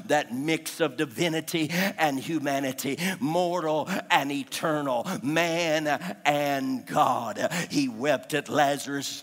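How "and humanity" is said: ordinary talk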